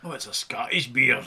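a light mock Scottish accent on the line; tossing off a hint of Scottish burr. To the co-host, a jokey Scottish accent. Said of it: "That was a Scottish accent".

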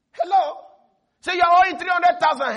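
ordinary talk

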